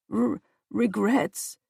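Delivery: slow, soft frightened stammer. Acted. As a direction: stumble on the word in fear. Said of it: "he stammered".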